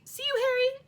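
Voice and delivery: high squeaky farewell; squeak. A narrator's reading. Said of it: "They heard him squeak".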